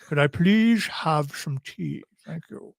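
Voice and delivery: lightly voiced posh English gentleman, slow; a hint of a stuffy British accent, drawn out; mock posh British. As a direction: play a prim Englishman asking politely for tea. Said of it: "He actually talks like this all the time".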